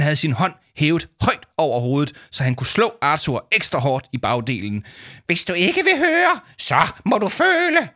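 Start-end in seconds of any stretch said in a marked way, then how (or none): 5.3-7.9 menacing villain. Acted. Adviser raising his hand to spank, shrieking threat. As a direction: gloat over the punishment.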